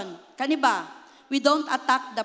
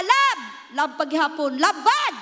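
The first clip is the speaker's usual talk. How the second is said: mock angry yell; 4 dB louder than usual. Husband yelling at his wife, mock shout. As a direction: bark the name like a cranky husband.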